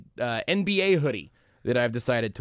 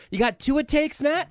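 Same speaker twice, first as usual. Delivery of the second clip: excited; 12 semitones higher than usual. Real, not acted. Host prompting guest excitedly.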